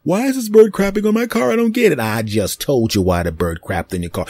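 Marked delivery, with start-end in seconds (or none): 0.0-2.0 high, whiny mock complaint; mock the confused victim; clueless car owner. Mocking people puzzled by bird droppings, high whine.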